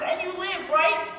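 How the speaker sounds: ordinary talk, nothing marked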